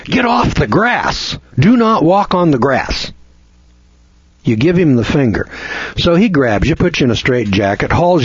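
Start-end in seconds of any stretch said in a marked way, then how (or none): 0.0-3.1 higher, slowed bossy shout at a patient; mock guard yelling. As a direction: ham up a guard barking orders. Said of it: "And the guard over there says".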